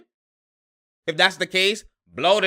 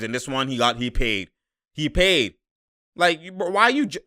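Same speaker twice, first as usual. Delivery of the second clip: ordinary delivery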